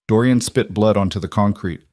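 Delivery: usual speaking voice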